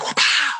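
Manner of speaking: sharp mock whip-crack sound; belt crack noise. Imitating the belt from his childhood, a sharp whap. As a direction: smack it out like a belt snapping. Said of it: "it'd be like".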